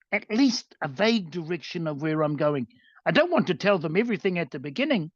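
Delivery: normal talking voice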